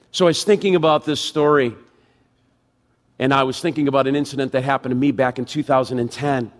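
ordinary talk, nothing marked

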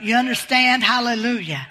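ordinary delivery

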